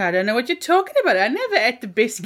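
put-on fast, breathless little-kid denial; rushed toddler denial. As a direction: blurt out the lie quickly. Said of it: "And he goes".

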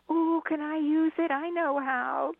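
high, eager, then slow "I know how"; eager childish voice. Pretend. New wife bursts in eagerly.

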